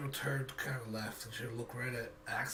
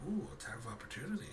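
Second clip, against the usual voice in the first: whispered tease; 5 dB quieter, much faster than usual. Real, not acted. GM teases player moving past enemy.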